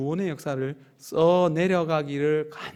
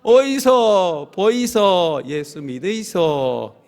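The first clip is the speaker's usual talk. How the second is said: slow, louder Busan-dialect call; loud Busan market call; 2 semitones higher, 8 dB louder, much slower than usual. Shown as a demonstration. Pastor leading the third round, loud Busan market call. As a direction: shout it out once more like a hawker. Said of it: "한번 더 하겠습니다".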